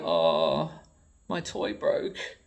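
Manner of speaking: high, slow, whispered little whine; mock whine. Mocking Americans who break their toys, babyish whine. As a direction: whimper it like a sulky child.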